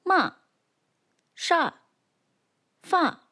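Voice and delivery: "Ma, sha, fa" each falling sharply from high; fourth tone. Shown as a demonstration. Teacher demonstrates the fourth tone, "Ma, sha, fa" falling sharply. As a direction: drop the pitch steeply on each of "Ma, sha, fa". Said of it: "The fourth tone falls like this".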